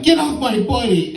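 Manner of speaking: high-pitched retold outcry; urgent protest. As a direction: call them off your friend, pitch jumping up. Teller acts defending his buddy.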